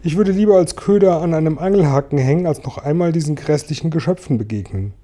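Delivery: high-pitched lament; wailing. A narrator's reading. Blind earthworm dreads the cloud men, wailing. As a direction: bemoan it, easing down in volume towards the end. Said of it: "jammerte der arme blinde Regenwurm".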